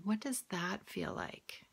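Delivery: soft unvoiced whisper; hushed guided question. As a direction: breathe the question gently.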